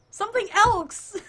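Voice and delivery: high, loud blurt; real flustered insistence; exasperated protest. Real, not acted. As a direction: yell it out on the edge of laughter. Continued laughing protest.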